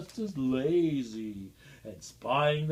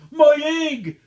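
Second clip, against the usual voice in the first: higher, louder excited shout; 9 semitones higher, 11 dB louder than usual. A narrator's reading. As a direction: cry it out as Horton. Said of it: "shouted Horton".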